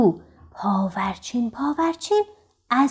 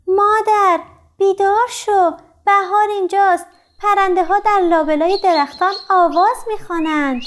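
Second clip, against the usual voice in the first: high, raised cub voice; excited little bear's cry; 8 semitones higher, 4 dB louder than usual. A narrator's reading. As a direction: call mother bear awake, high and eager. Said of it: "خرس کوچولو فریاد زد".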